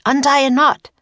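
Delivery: loud, high command; urgent instruction. Acted. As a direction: call out the order urgently. Urgent instruction while wolves chase.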